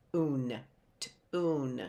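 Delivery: "Tune" split as tuh, oon; sounded-out "Tune" with a gap; unblended word. Shown as a demonstration. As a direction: break "Tune" after the T. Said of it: "we still have to blend it".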